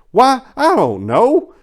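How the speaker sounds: usual speaking voice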